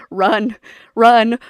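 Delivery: loud, urgent call to flee; playful warning shout. Real, not acted. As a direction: yell at them to run from spoilers. Warning Paperlin about spoilers.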